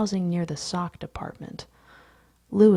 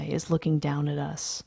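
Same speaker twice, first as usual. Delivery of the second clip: ordinary delivery, nothing marked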